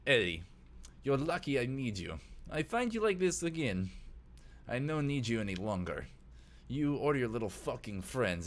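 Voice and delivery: put-on lightly menacing Russian accent; put-on Russian accent, warning; Vlad, Russian accent. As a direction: threaten like a Russian crime boss. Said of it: "Russian accent".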